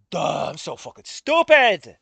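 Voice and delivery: loud furious self-scolding; self-directed anger. Real, not acted. Streamer cursing himself over the wrong answer, loud and furious. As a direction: spit it out, mad at yourself. Angry at own mistake.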